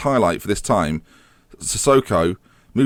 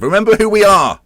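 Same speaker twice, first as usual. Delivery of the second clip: high, loud call to the team; rallying cry; 10 semitones higher, 7 dB louder than usual. Real, not acted. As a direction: rouse the players. Rallying his team in-game.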